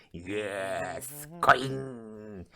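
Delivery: drawn-out put-on voice; lingering odd chant; eerie coin talk. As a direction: stretch both words, humming the end. Voicing a talking coin exchange.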